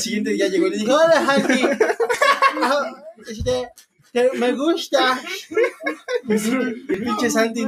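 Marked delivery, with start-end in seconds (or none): 0.9-5.2 higher-pitched, halting mimicry of an admirer; hammed-up coy voice, nearly laughing; mock bashful confession. Friend mimics a girl confessing to Santi, mock bashful. Mocking retelling of a shy confession.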